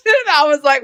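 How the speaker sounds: normal talking voice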